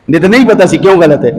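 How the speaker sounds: ordinary talk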